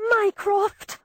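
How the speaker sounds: high, outraged shout. A narrator's reading. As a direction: shout his name in outrage. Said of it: "I shouted".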